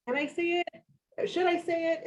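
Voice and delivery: high, slow, hesitant voice; timid self-doubt. Retold or imitated. Panel host voicing doubtful women afraid to claim success. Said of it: "we're like".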